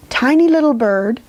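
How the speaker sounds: ordinary delivery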